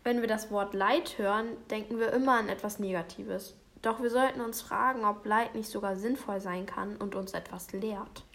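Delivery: usual speaking voice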